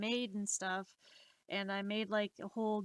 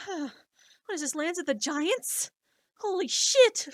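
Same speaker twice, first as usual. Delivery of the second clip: slow start, then a much higher, much louder shout; shocked shout; 7 semitones higher, 9 dB louder than usual. Retold or imitated. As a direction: build from slow disbelief into a shouted curse. Shocked by tall kids.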